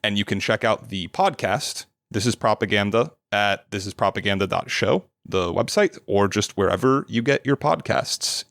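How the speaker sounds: ordinary talk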